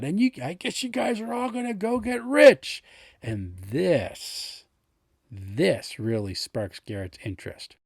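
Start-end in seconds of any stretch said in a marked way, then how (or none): 0.0-2.8 mocking townsperson. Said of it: "mentions something about".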